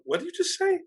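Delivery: very high-pitched, aghast question; stunned mom. Retold or imitated. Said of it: "her tongue hanging out going".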